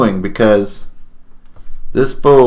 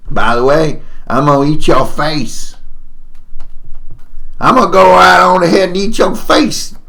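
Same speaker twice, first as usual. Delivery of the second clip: gleeful threat, rising higher; crazy old prospector; 8 semitones higher than usual. Acted. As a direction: build to a high prospector holler.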